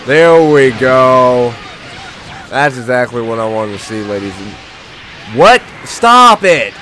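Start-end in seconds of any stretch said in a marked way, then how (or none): 0.1-1.5 slow, loud, stretched-out cheer; drawn-out triumph. Acted. Gamer celebrating a landed combo, slow and triumphant. 5.4-6.7 higher, louder protest; exasperated yell. Real, not acted. Gamer yelling at opponents ganging up on him. Yells at attacking enemies.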